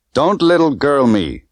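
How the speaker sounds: slow, scornful put-down; scornful rebuke to Bond. Acted. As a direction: sneer the words at him, slowly. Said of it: "she said scornfully".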